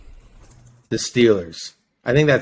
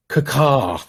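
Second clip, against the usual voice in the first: mock bird caw; 2 semitones higher than usual. Bird teams matchup.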